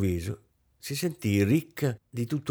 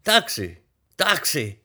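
raised voice hailing a taxi; calling a taxi; 6 semitones higher, slower than usual. Acted. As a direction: call out across the street for a cab. Calling out for a cab.

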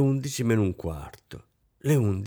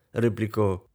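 ordinary delivery, nothing marked

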